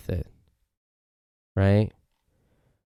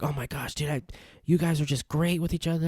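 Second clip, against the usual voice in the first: friends' gushing praise; 7 semitones higher, 6 dB quieter than usual. Retold or imitated. Acts out friends' excited praise.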